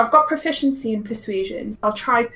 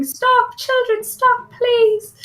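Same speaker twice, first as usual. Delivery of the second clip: put-on much higher, squeaky call to the children; a small halfling pleading, pitched way up; sweet squeaky halfling; 13 semitones higher, slower than usual. Halfling calling panicked children to stop, high squeaky plea. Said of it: "her sweet, squeaky voice shouting".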